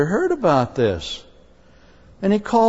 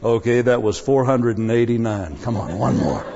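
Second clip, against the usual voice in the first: lower, grudging tally of forgiveness; mock counting; 7 semitones lower than usual. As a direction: grumble the count down low. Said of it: "You can't say".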